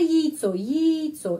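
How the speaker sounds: яйцо with the first syllable yi; yi sound. Shown as a demonstration. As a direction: start яйцо with a short yi. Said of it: "ya bacanya yi". (яйцо dibaca yi di awal)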